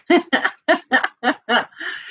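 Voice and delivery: high, light laugh at herself; short laugh. Real, not acted. Woman laughing at her own cursing story, high and light. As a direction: laugh off the memory.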